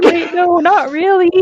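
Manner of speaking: mock protest; imitating Zane. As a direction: squeak out the backpedal. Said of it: "Zane's like".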